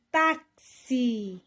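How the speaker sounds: táxi split, with a firm TÁ; penultimate stress demo. Shown as a demonstration. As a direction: sound out táxi, punching TÁ. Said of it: "paroxítonas acentuadas terminadas em I".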